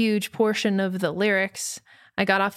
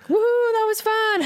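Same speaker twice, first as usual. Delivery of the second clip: gleeful whoop; 13 semitones higher than usual. Retold or imitated. Buddies leaving after ruining show.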